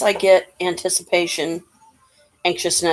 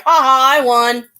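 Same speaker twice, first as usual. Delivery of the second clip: loud, higher mock-gloating laugh; smug victor; 7 semitones higher, 8 dB louder than usual. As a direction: crow over a win with a smile. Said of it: "this smile on his face".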